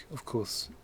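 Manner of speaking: usual speaking voice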